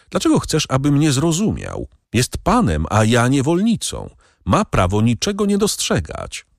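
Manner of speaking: ordinary delivery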